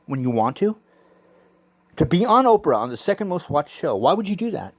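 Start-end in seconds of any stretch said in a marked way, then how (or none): none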